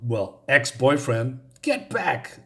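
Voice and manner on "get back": high-pitched yell held back in volume; mock yell. Woman to her ex-boyfriend as danger closes in, mock yell. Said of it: "she yells at her".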